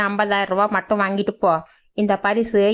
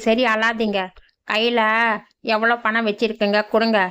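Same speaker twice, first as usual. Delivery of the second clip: ordinary delivery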